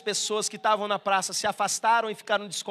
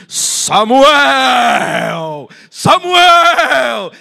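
booming, eerie ghostly call, much louder; 5 semitones higher, 18 dB louder, much slower than usual. Shown as a demonstration. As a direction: call the name like a haunting spirit. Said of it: "Que isso, voz de assombração".

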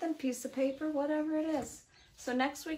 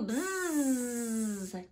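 long sustained bzzz; playful drawn-out bee sound; bee buzz. Acted. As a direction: stretch out a bee's buzz mid-question. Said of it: "says the bee".

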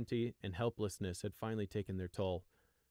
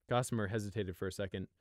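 usual speaking voice

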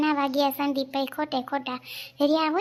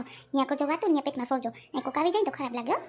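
normal talking voice

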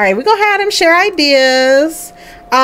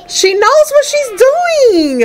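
excited; 12 semitones higher, slower than usual. Real, not acted. Defending the baby.

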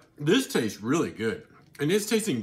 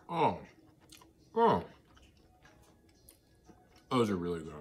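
usual speaking voice

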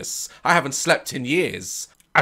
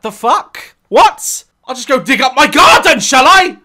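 louder, higher outraged sarcasm; exasperated dad; 9 semitones higher, 9 dB louder than usual. Retold or imitated. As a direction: balk at the homework request. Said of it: "I'm like".

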